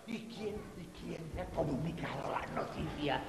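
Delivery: hushed, breathless whisper; library whisper. Acted. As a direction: whisper it so nobody hears. Habla bajito. (susurro de biblioteca)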